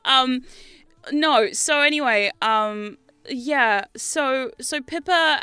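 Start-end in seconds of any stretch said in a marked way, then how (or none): none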